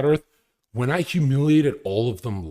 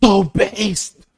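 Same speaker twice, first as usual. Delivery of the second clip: very high, loud mock outburst; hammed-up shout of approval; overexcited reaction; 8 semitones higher, 10 dB louder, much slower than usual. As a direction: erupt like an overhyped fan. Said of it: "if you're doing what most people do".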